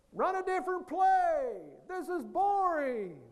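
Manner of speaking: loud, high mock yell from the stands; parents yelling. Parents in the stands yelling at the coach, loud high mock yell. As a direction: holler it like annoyed parents in the bleachers. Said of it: "Parents are yelling from the stands".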